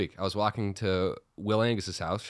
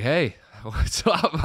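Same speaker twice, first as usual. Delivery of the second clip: awkward laughing greeting; 5 semitones higher, 4 dB louder, much slower than usual. Retold or imitated. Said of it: "I'm terrible at it".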